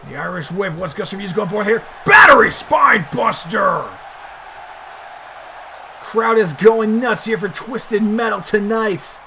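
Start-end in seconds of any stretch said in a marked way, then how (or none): 2.0-3.9 loud, drawn-out commentator shout; stretched-out ringside yell; excited move call. Real, not acted. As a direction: call the big move with a slow, booming shout. Calling a big wrestling move.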